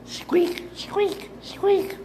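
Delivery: very high squeaky rat voice; mocking squeak. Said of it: "The rat has performed well".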